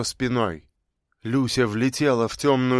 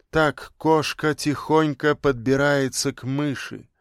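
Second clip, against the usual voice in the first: plain reading voice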